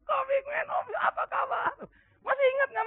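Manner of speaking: high, delighted cry of recognition; excited greeting. Retold or imitated. Grown pupils spotting their old teacher, excited high cry. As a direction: call out like a thrilled former pupil.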